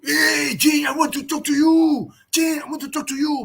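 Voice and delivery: high-pitched mock French accent, calling out; Waza. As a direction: call after someone in a hammed-up French accent. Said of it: "Waza is conscious like".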